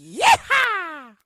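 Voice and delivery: loud, high cowboy whoop. Pretend. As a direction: let out a rowdy cowboy yeehaw. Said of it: "cowboy voice going right now".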